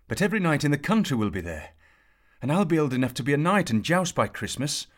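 high, loud, fast whine; moaning. A narrator's reading. Kay moaning to his father about the joust, high and fast. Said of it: "moaned Kay".

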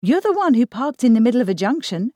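quick, high, loud complaint; indignant grumble at a friend; grumbling retort. A narrator's reading. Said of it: "grumbled Lucia".

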